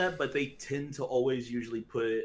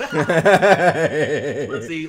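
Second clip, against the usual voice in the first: laughing; 4 semitones higher, 14 dB louder than usual. Real, not acted. Laughing while starting a list.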